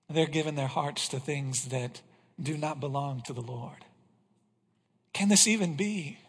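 ordinary delivery

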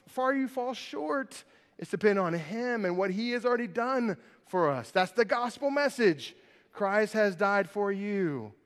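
ordinary talk, nothing marked

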